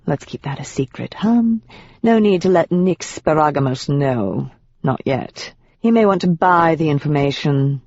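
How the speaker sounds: lightly voiced proper British demon, smug and unhurried. A demon in proper British, teasing Rachel about secrets. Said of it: "lower and laden with a proper British accent".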